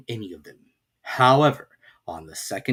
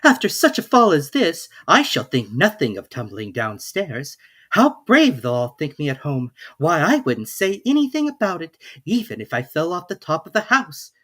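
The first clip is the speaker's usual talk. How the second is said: lightly voiced young girl, higher; Alice; 5 semitones higher, 5 dB louder than usual. A narrator's reading. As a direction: read Alice's lines a little higher and brighter in tone. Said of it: "thought Alice to herself".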